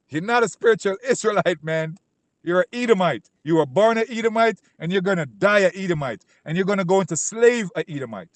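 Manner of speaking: preacher shouting down an Edomite to his face; fiery denunciation. Real, not acted. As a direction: condemn him at full volume.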